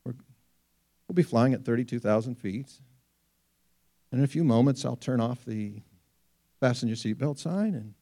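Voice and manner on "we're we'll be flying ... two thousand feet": low, quiet, smooth captain's voice over the intercom; unruffled pilot on the PA, lightly imitated; calm pilot announcement. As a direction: soothe the cabin like an airline pilot.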